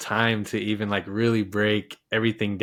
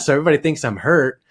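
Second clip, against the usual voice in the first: ordinary talk, nothing marked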